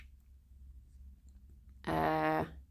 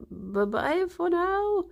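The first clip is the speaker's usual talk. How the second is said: slow, high sing-song farewell; sing-song goodbye; 10 semitones higher, slower than usual. Acted. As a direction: stretch the goodbye out sweetly. Sign-off farewell to viewers.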